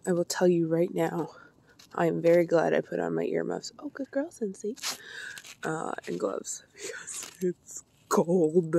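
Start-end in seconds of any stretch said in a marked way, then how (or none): 3.8-4.8 pet talk. Real, not acted. Talking sweetly to her dog.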